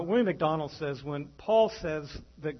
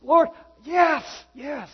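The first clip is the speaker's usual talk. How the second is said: high, loud anguished yes; desperate Peter; 11 semitones higher, 10 dB louder, slower than usual. Retold or imitated. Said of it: "Third time".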